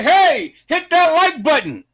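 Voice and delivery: half-joking yell at stingy viewers to hit like; mock holler. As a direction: holler it like you're fed up calling them. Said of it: "I shouldn't have to scream and holler".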